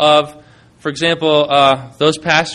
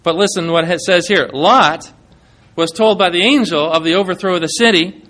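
normal talking voice

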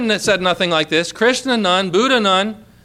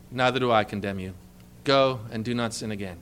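tender forgiveness; 9 semitones lower, 7 dB quieter than usual. Acted. Jesus to the woman, softer and lower, tender.

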